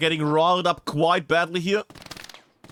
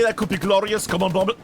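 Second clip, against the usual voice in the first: usual speaking voice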